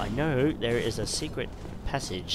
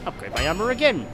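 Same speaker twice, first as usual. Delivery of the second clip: higher, exclaiming, falling on "again"; excited exclamation; 6 semitones higher than usual. Real, not acted. Gamer delighted at upgrading again, excited. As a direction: exclaim it with a lift through "armor again". Exulting over another upgrade.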